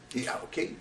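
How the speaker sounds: ordinary delivery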